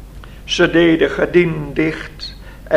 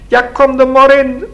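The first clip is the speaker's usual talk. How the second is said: high, loud call to come in; 9 semitones higher, 6 dB louder than usual. A narrator's reading. Lina answers the visitor at the door, calling out. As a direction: call through to someone outside. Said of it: "riep Lina".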